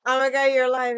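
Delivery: hammed-up amazement again; mock surprise. Mocking the friend's text a second time, loud. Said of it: "I didn't reply to you the whole weekend".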